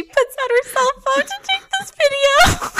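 high squeal through suppressed laughs; real helpless laughter. Real, not acted. Telling a friend the awful part, helpless laughter. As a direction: squeeze the words out while cracking up. Cracking up at own sad story.

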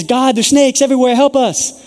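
high, loud, desperate cry to God; begging for rescue in a panic; panicked plea. Acted. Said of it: "And everyone's freaking out".

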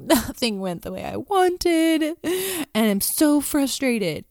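slow, high, exasperated complaint; frustrated venting. Retold or imitated. To her husband, venting about the failed day, frustrated. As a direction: drag it out in retold frustration. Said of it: "I was, like, processing with him".

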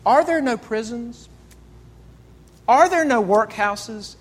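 the ghost quoting Scrooge back to him, accusing; ghost's rebuke. Acted. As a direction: throw Scrooge's own words back at him. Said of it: "the ghost looks at him and says".

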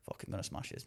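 quiet whispered self-hype; cocky whisper. Retold or imitated. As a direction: whisper the boast to yourself. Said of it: "I was just like".

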